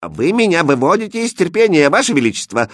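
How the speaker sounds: mildly furious princess; angry. A narrator's reading. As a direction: speak crossly, patience running out. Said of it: "зло проговорила принцесса".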